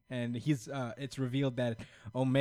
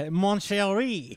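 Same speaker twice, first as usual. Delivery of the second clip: high, loud, drawn-out mock French; bad French accent; 9 semitones higher, 8 dB louder, slower than usual. Host keeps the French vampire bit going, hammed-up French accent. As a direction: croon it as a cartoon French vampire. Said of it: "I can't really do a French accent".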